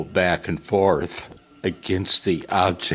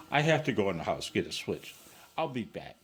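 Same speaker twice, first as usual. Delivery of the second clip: ordinary talk, nothing marked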